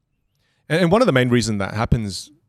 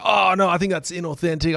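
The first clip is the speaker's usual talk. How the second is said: higher-pitched mock student protest; objecting student; 7 semitones higher than usual. Students' objection voiced in retelling.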